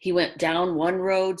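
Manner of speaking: ordinary delivery, nothing marked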